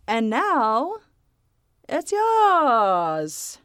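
hammed-up handover, last word stretched high; weird segue. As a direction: hand over with a long, rising mock flourish. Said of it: "that was a really weird segue".

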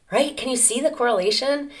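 ordinary talk, nothing marked

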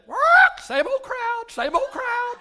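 high squawking parrot. Acted. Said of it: "Parrot said".

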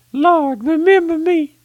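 high, loud, straining cry of a dying man; desperate high plea; dying thief's plea. Acted. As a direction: cry it out to the Lord from the cross.

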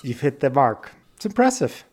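normal talking voice

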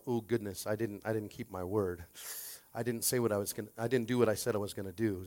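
low, soft self-reproach; quiet, sinking inner monologue; thinking to himself. Acted. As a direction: murmur it as a private, guilty thought. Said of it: "probably thinking to himself".

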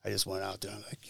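ordinary delivery

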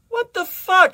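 high, loud, exasperated protest; indignant. Acted. Desmond demanding why he was cut short, indignant. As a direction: squawk it in disbelief.

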